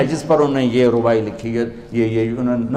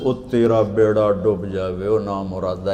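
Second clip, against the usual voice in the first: low, slow Punjabi cursing; lamenting curse; 6 semitones lower, 4 dB quieter than usual. Retold or imitated. Scholar to the man who drowned his books, low lamenting curse. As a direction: curse him low in dismay.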